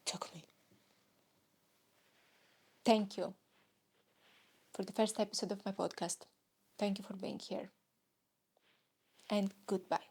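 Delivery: a hint of a shy Japanese host signing off; Japanese man persona. Podcast host playing Takumi, lightly voiced. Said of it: "asking to be a Japanese man".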